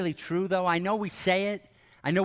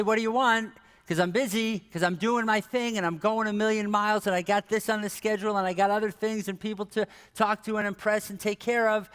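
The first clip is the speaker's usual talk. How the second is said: higher, busy run-on excuse; harried and impatient; 4 semitones higher than usual. Acted. Busy believer puts Jesus off, harried and impatient. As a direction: reel off obligations, put-upon.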